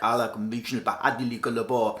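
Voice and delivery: made-up Arabic-sounding gibberish, Ala kumishnu bahadili kulabo; fake foreign language. Shown as a demonstration. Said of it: "you try to speak Japanese or Arabic based on how it sounds".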